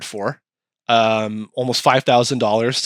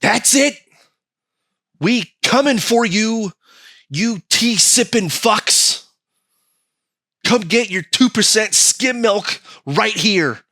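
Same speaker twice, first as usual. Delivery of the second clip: defiant forefathers; 6 semitones higher, slower than usual. Retold or imitated. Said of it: "Our forefathers said".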